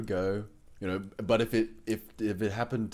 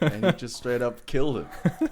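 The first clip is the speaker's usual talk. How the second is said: higher, louder, breaking into laughter; laughing punchline; 4 semitones higher, 5 dB louder than usual. Real, not acted. Player tells how Grandpa killed the deer, laughing. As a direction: land the punchline through laughter.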